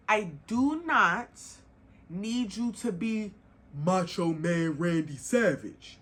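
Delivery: slow, measured, each word placed; slow it right down to stay calm; deliberate. Real, not acted. Forcing herself to stay calm, slow and measured. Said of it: "let me calm down".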